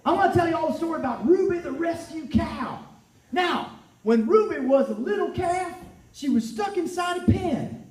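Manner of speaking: put-on young farm boy, bright and eager; Billy Brown, cheerful. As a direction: tell it as a chirpy young farmer's son. Plays Billy telling story.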